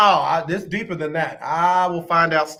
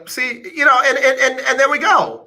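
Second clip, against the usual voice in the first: pleased triumph; 8 semitones higher than usual. Real, not acted. Triumph after clarification.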